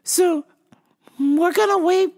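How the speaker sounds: high, halting confused customer. Retold or imitated. Customer asking the clerk for the vitamin man, high and confused.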